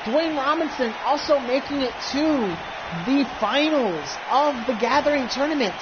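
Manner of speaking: wrestling announcer. Acted. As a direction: hype ringside announcer call. Ringside announcer introduces champion.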